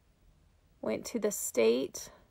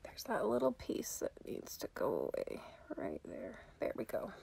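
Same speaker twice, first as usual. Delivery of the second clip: whisper; 2 semitones lower, 10 dB quieter than usual. Real, not acted. Whispering while doing makeup.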